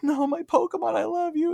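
very high, hammed-up wail; a mock falsetto cry of grief; mock sobbing trainers. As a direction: squeal it like melodramatic trainers. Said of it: "They're like".

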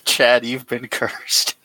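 slow words through laughter; fighting back laughter; laughing. Real, not acted. Laughing delivery.

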